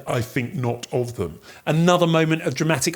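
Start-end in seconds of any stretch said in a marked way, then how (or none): 0.0-1.4 a low, soft reply, lightly in role; lightly voiced Macbeth. Macbeth deceiving his old friend Banquo, lightly voiced low and soft. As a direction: drop lower and quieter for the character's answer. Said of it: "Macbeth responds with".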